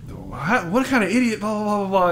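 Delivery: high-pitched mock tirade; mock ranting. Squidward goes off at the Dutchman, high mock rant. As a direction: go off in an imitated, indignant rant trailing into gibberish. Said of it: "Squidward just goes off".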